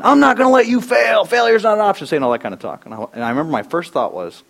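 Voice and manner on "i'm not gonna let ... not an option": high, loud coach shout; mock coach yell. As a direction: fire up the runners. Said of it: "he's yelling".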